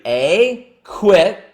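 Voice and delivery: usual speaking voice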